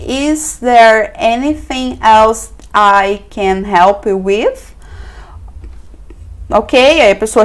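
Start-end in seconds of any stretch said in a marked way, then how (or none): 0.1-4.6 Is there anything else drawn out word by word; very slow model phrase. Shown as a demonstration. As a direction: spell it out slowly for the learner. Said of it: "aí você vai lá e fala o seguinte". (bem devagar, frase modelo)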